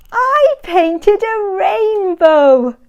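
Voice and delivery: lightly voiced little Spot; proud little Spot. A narrator's reading. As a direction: say it like a proud small puppy. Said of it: "said Spot".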